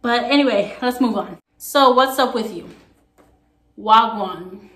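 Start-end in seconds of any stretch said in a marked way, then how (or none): 3.8-4.7 "Wagwan" run together as one word, wag-wan; Patois wagwan. Shown as a demonstration. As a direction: greet casually with "Wagwan". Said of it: "It's a kind of the same thing".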